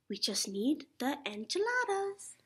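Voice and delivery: ordinary talk, nothing marked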